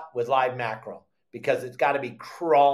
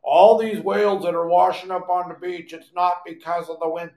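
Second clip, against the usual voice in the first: mocking officials; 3 semitones higher, 5 dB louder than usual. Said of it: "standing on top of their soapbox going".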